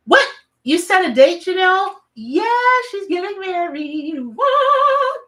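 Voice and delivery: gasp, then loud high-pitched squeals; excited shrieking congratulations, voice shooting way up; thrilled surprise. Real, not acted. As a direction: react with delighted shock, drawing out the big news. Reacting to a friend's engagement news.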